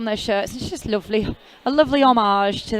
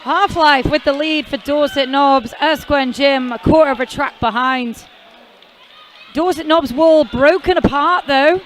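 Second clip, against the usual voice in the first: excited commentary, louder and pushing, calling the jam as it happens; live play-by-play; 3 semitones higher, 4 dB louder than usual. Real, not acted. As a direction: call the action live, energy rising. Live roller derby play-by-play.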